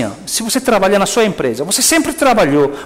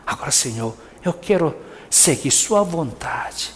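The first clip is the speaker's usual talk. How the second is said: humble prayer; 2 semitones lower, 7 dB quieter than usual. Pretend. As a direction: pray softly, yielding to God. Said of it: "agora mais uma coisa".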